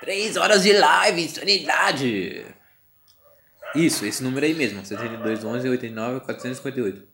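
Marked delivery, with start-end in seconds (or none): 0.0-2.5 drawn-out excited shout. Shown as a demonstration. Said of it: "repeated, then whispered".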